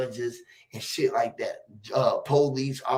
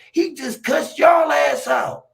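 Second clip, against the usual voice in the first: high, loud and slow protest; retold outburst; 12 semitones higher, 7 dB louder, slower than usual. Telling the teacher off about the boy, loud and high. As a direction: throw it back at the teacher, loud. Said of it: "I said".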